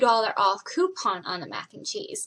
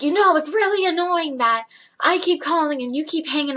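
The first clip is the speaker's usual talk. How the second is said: retold complaint, pitched up in indignation; annoyed customer; 6 semitones higher, 4 dB louder than usual. Caller complaining to the worker about being hung up on, annoyed. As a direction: imitate an irate caller, higher and louder.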